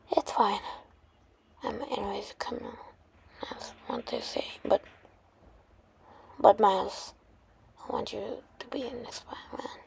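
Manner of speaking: barely audible, halting whisper; dying last words. Acted. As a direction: let the voice fade away as if dying. Dying Peter's last words to Miles.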